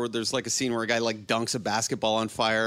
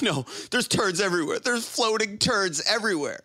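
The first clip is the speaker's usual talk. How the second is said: higher-pitched mock distraught wife; imitated freak-out; 7 semitones higher than usual. Wife reporting the basement mess to husband, imitated freak-out. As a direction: act out her panic without real tears. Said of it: "she's bawling. She's freaking out".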